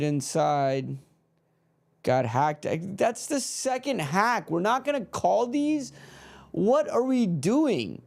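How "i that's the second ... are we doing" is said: higher-pitched, exasperated protest; a fan protesting the unpunished hacks; indignant complaint. Real, not acted. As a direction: rail at the referee. Complaining about fouls not called.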